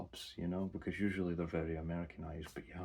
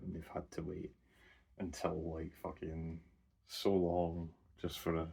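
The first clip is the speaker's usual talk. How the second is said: ordinary talk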